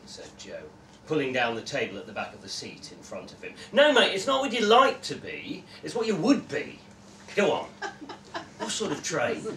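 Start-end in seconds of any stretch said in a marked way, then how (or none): 3.7-9.4 loud, pushy mate voice; hearty bloke, needling his friend; brash Gary voice. Acted.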